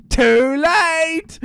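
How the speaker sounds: loud, high singsong taunt; mocking. A narrator's reading. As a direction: crow it gloatingly at your prey. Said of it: "mocked the dingo".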